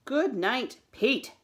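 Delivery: loud, high, firm dismissal; great finality. A narrator's reading. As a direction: shut the discussion down with a firm good night. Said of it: "said with great finality".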